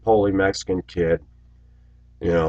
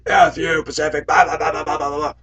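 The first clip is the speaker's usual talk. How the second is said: higher, mock whiny chorus; mocking the guys; 8 semitones higher than usual. Chat room guys jeering at Pacific, higher mock voice.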